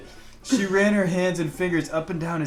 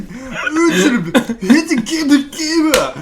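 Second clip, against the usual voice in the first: high, louder mock muffled gibberish; 7 semitones higher, 4 dB louder, slower than usual. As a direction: mumble it like a mouth full. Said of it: "Her muffled voice sounded out".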